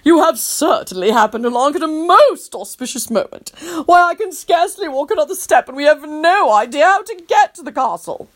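prim, fussy Tony; a lightly voiced proper gent, exhausted; fussy gentleman. Tony, tired of walking, begs a ride politely.

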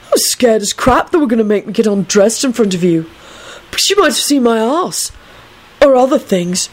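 lightly voiced tipsy young man; a touch of stoned earnestness; stoned rambling. A narrator's reading. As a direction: ramble with loose, drugged candour. Stoned Neville rambles to Kingsley.

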